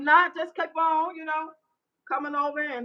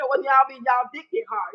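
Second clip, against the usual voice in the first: usual speaking voice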